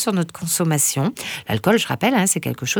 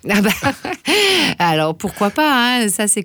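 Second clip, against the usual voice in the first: laughing; 5 semitones higher, 3 dB louder than usual. Real, not acted. Laughs at February joke.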